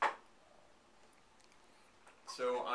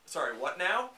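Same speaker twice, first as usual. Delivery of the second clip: puzzled, higher and louder with a rising end; imagine hearing a baffling title and query it; baffled reaction; 4 semitones higher, 5 dB louder than usual. Acted. Moviegoer hearing a bad title for the first time, baffled. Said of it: "when you first hear them, you think".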